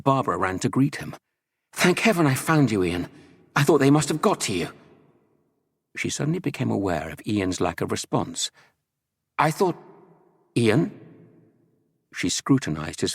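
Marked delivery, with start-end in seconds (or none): 1.7-4.7 quick, high relieved voice; relieved and eager. A narrator's reading. Relieved on finding Ian. 9.4-10.9 high questioning voice; puzzled. A narrator's reading. Notices Ian's blank stare.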